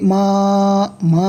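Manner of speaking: মা with its vowel held one count; one-alif elongation. Shown as a demonstration. Teacher demonstrates for learners, lengthened মা. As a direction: stretch the vowel of মা evenly. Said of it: "এক আলিফ পরিমাণ টান দিয়ে পড়তে হবে".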